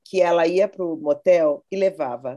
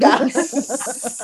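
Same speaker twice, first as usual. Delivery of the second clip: laughing; 5 semitones higher than usual. Real, not acted. Mocking friend's diagnosis.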